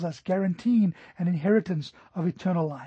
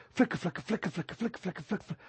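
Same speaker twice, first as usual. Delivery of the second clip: softly imitated slapping of running flip-flops; rapid, hushed footstep noise made with the mouth; imitated flip-flop patter; 8 dB quieter, much faster than usual. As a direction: patter it out quietly, like sandals hurrying closer. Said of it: "I heard those flip-flops".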